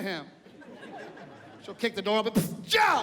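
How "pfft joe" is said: mom barking. Retold or imitated. Mom kicking door open.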